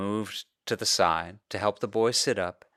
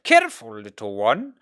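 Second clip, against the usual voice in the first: startled warning; 4 dB louder than usual. Acted. Bitten father warning boy.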